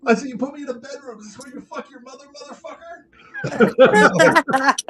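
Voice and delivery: very high-pitched, taunting parrot; cussing parrot. Acted. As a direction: squawk insults as a defiant parrot. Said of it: "It's like".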